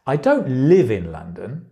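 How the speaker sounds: "live" leaned on, with a rise at the end; stress on LIVE. Shown as a demonstration. Teacher showing how stress changes meaning, "live" stressed. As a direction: lean on the word "live". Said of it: "where you put the stress changes the meaning".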